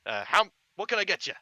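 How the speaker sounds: big burly kebab seller greeting a customer; burly vendor. Acted. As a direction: boom a cheery hello at a customer. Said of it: "and he goes".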